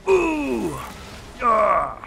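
high, long mock battle howls; Viking war cries. Player as Viking raider, drawn-out hammed-up cries. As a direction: howl like a pretend warrior. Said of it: "that's my Viking voice".

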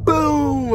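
high boom sliding down; imitated thunder boom. Mimicking the instrumental heard in hell, high falling boom. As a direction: start high and let the boom fall. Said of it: "but I could do that".

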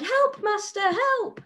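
high, piping cry for help; harp crying for help. Acted. Teacher voicing the harp as it calls its master, high cry. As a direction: squeal it out like a frightened little harp. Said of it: "the harp cried".

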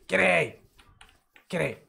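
ordinary delivery

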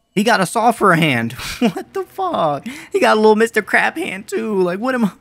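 high, loud, laughing incredulity; laughing disbelief. Real, not acted. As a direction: crack up in shock at a ridiculous monster. Laughs in disbelief at monster's hands.

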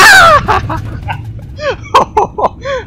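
high, strained panicked laugh-shout; shrieking laugh. Real, not acted. Game won't let him rest. (หัวเราะแหลมปนตกใจ)

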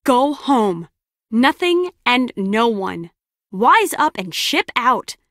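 firm, slowed-down orders; slow, deliberate and emphatic; saying it slow. Acted. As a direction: spell it out for someone not listening. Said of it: "I'll say it slow".

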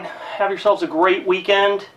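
usual speaking voice

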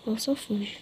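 ordinary talk

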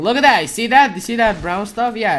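high, loud eager pointing; excited shout. Real, not acted. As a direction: shout it like a discovery.

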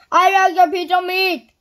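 loud, high booming shout. A narrator's reading. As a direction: bellow the order across the shop. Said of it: "he boomed".

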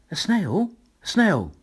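high then loud shocked exclamation; teacher shocked to see a snail. A narrator's reading. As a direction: question it, then blurt it out.

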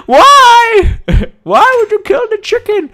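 high, loud, laughing mock despair. Real, not acted. Gamer laughing in mock despair at the dead chicken. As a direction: howl why while laughing.

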